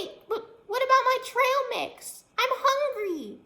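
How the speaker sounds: very high, loud, slowing whine; a hungry little critter pleading; whiny chipmunk. Acted. Hungry chipmunk whines at the girl for food, squeaky. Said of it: "said the very strange chipmunk".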